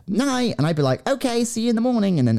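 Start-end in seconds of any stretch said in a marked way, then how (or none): 0.1-0.6 high, light retold goodnight; sing-song goodnight. Retelling their evening goodnights, high sing-song. 1.1-2.2 high, sing-song retold sign-off; chirpy goodnight. Retelling how she said goodnight, high and chirpy. Acts out her cheery goodnight.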